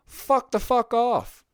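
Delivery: high, drawn-out angry fuck the fuck off; exasperated outburst. Real, not acted. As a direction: stretch it slowly with fed-up rage. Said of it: "I'll say it right here".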